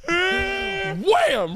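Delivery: high-pitched crash exclamation; mock crash cry. Cohost acts out veering into the median, high crash cry. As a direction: shoot up high for the impact.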